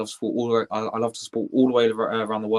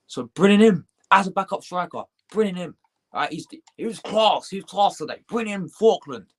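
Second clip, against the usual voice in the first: excited demand; 8 semitones higher than usual. Real, not acted. Demanding Arsenal sign striker.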